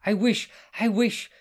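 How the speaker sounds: high, loud angry stamping; stamping wish. Acted. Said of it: "stamped Hudayfa".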